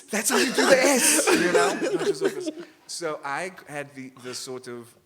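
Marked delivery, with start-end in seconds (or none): 0.1-2.5 much higher and louder, laughing excitement; delighted discovery. Retold or imitated. Child discovers the S at last, laughing and squealing. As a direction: squeal with joy at finally getting the S.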